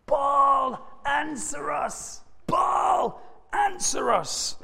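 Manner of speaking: high, drawn-out mock yell to a god; acted yell. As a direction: call out to Baal as if from afar. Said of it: "they yelled".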